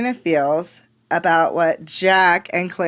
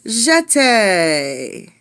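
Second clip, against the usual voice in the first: ballet teacher call; 3 semitones higher than usual. Acted. Ballet teacher calling steps to a class. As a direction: call the step like a ballet mistress.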